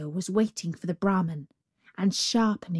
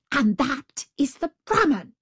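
higher, exasperated pointing-out; irate tiger spelling it out; enraged tiger; 7 semitones higher, 4 dB louder than usual. A narrator's reading. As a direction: explain with acted impatience. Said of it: "in a rage".

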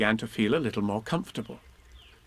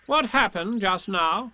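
slow, higher, important-sounding question; slow, raised, solemn inquiry; 7 semitones higher, slower than usual. A narrator's reading. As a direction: question someone with self-importance. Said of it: "he said solemnly".

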